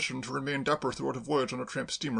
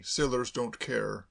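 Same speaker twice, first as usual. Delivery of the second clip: usual speaking voice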